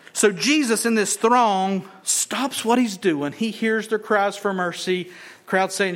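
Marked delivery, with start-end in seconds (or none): none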